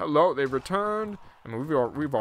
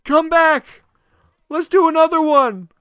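high, loud begging; pleading shout; 14 semitones higher, 11 dB louder than usual. Real, not acted. Gamer begging the tournament to come back. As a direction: beg the tournament to return.

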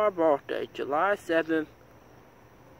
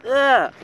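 frustrated groan; 6 semitones higher, 8 dB louder than usual. Real, not acted. Frustrated at flubbing title.

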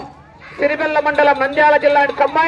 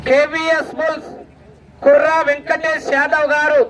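normal talking voice

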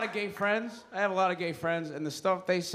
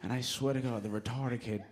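normal talking voice

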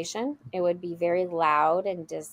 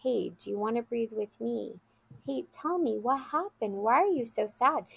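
higher, gentle comforting voice; calm, caring child; 5 semitones higher, 3 dB quieter than usual. Retold or imitated. Child comforts his sad friend beside her, calm and gentle. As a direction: imitate a child softly consoling a friend. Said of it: "he has to stay calm for her".

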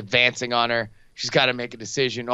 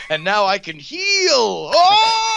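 high, slow, loud wail; hammed-up old woman crying out, stretching the words; mock old woman; 12 semitones higher, 5 dB louder, much slower than usual. Podcaster mimics old woman discovering new powers, drawn-out mock wail. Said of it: "the old woman's like".

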